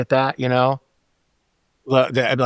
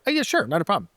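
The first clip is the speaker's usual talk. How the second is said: bright, much higher, softer easy yes; cheerful agreement; 7 semitones higher, 4 dB quieter, faster than usual. Retold or imitated. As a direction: sound happy to help. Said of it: "I'm like".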